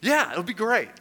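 high, loud eager pitch; gung-ho friend selling the idea; mock enthusiasm. Friend talking up going round the circle, enthusiastic.

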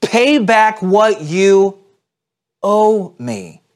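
slow, loud, high angry demand. Acted. Servant choking his debtor, slow furious demand. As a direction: drag out each word like a furious creditor. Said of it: "He's angry".

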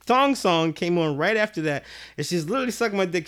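ordinary delivery

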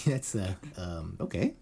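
ordinary talk

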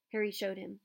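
ordinary delivery, nothing marked

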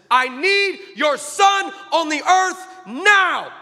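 God thundering the command; booming shout. Retold or imitated. God shouting his command to the preacher, slow booming shout. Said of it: "he shouts in me".

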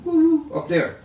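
high excited whoop; excited woo. Real, not acted. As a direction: marvel at it with a soaring cry. Marvelling at Miles' otherworldliness.

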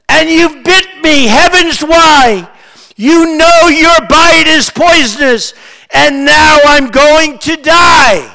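loud, high, slow recited lament; crying out. A narrator's reading. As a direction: declaim it as the bitten woman's outcry. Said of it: "cried the woman".

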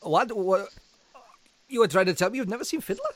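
fast, high shocked disbelief. Real, not acted. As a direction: react in total shock. Shocked at never seen Fiddler.

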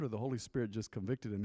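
normal talking voice

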